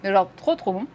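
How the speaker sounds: chewing while talking; mouth full. Real, not acted. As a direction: say it through a mouthful of pastry. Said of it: "parler avec la bouche pleine".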